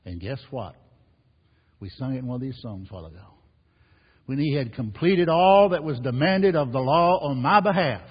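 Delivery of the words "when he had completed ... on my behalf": higher and much louder, building; full-force preaching. Acted. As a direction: thunder it over the congregation.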